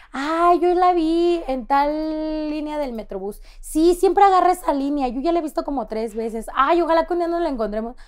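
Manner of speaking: excited fan voice; gushing fan. Retold or imitated. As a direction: fans gushing about spotting her. Fans commenting on TikTok.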